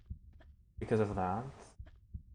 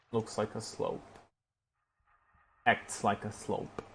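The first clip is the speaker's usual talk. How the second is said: much softer, musing under breath; quiet muttering; 8 dB quieter than usual. Real, not acted. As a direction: talk it over with yourself softly.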